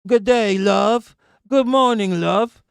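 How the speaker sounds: high, loud mock British accent; thick British accent. Imitating friends in Britain greeting people, thick British accent. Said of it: "They have a thick British accent".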